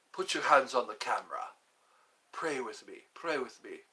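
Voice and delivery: lightly voiced faith healer; Benny Hinn. Faith healer urging viewers to pray, mild imitation. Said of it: "Benny Hinn used to do that".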